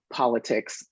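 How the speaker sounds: normal talking voice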